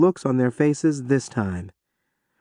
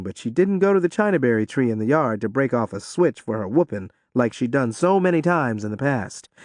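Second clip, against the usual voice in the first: ordinary delivery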